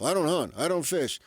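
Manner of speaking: ordinary talk, nothing marked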